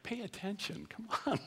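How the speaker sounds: a playful dad's sigh of disbelief; exasperated dad. Retold or imitated. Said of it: "So, I'm like".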